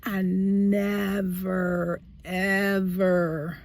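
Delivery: "I never, ever" drawn out very slowly, each word weighted; stress on never ever. Real, not acted. Telling viewers she was never hit before, slow heavy stress. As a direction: lean hard on "never" and "ever".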